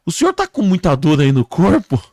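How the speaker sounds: high, loud questioning; rescuer questioning. Acted. Man questioning a crash victim, loud and high. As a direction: call out to the injured man. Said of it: "o homem chegou pra ele e falou".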